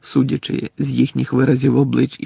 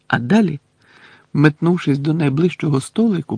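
plain reading voice

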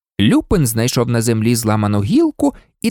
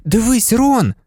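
higher-pitched sudden call; sudden exclamation; 9 semitones higher than usual. A narrator's reading. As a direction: exclaim it to a friend. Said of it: "вигукнув раптом Гаррі". (раптовий вигук)

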